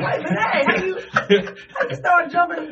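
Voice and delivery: high, loud mock protest with laughter; indignant disbelief. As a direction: protest like an outdone veteran athlete, hammed-up. Acted protest at a newcomer jumping.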